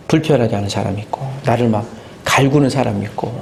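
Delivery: ordinary talk, nothing marked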